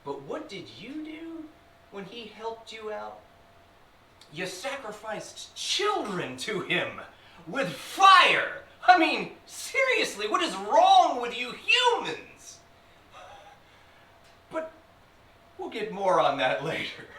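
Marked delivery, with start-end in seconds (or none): 4.3-12.6 high, loud incredulous demon; scandalized near-shout; appalled outburst. Acted. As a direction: cry out in disbelief at humans. Demon appalled at human cruelty.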